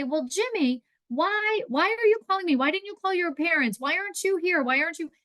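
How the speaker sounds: high, anxious questioning grandma; worried grandma. Acted. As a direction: pepper the caller with worried questions.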